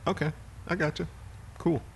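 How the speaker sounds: high, light "oh I see"; pleasantly surprised. Retold or imitated. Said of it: "it wound up being like".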